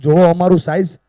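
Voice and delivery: loud, proud boast by the thumb; thumb bragging loudly to the other fingers. Acted. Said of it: "અંગૂઠાએ ઊંચો અવાજ કરીને કીધું".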